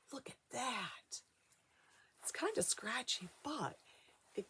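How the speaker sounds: hushed whisper over the fur; soft awed whisper. Acted. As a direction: whisper in awe to the kids. Showing wolf fur to children.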